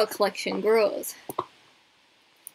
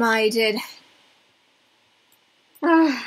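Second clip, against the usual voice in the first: ordinary talk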